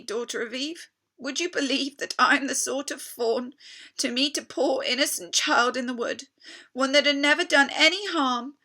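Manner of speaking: high, loud, pleading fawn voice; guilty fawn pouring out his shame to Lucy; anguished fawn. Acted. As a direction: beg her to see how wicked you are. Tumnus confessing he's a kidnapper.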